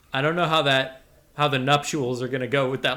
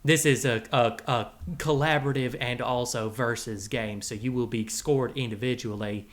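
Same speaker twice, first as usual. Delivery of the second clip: put-on cowboy character accent; 5 semitones lower, 7 dB quieter than usual. The Rattler presenting his Halloween game to the boys, cowboy accent.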